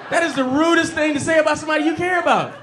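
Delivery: shouted high through laughter; laughing shout. Real, not acted. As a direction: yell it in disbelief, laughing. Repeats the line shouting.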